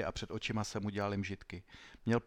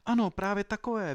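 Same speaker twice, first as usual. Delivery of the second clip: high, loud cry of recognition; excited cry; 10 semitones higher, 7 dB louder than usual. Acted. Sandro sees the magic boots again: excited cry. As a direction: shout out as he reaches for them. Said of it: "vykřikl Sandro".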